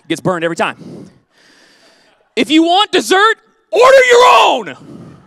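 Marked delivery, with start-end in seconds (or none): 2.4-5.2 shouting the rule with a high, stretched, slow last line; a playful stern shout at the congregation; mock stern rule. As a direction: bellow it like a house law, dragging out "order your own". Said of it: "crucial guidelines".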